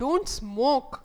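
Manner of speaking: teacher warning, Don't emphasized; stress on don't. Shown as a demonstration. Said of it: "don't కి stress వేస్తున్నాం".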